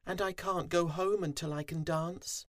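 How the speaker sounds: high, small, sorry voice; high-pitched, forlorn little bee; moaning little bee. A narrator's reading. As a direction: lament it as a dejected tiny bee. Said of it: "poor Fuzzy moaned".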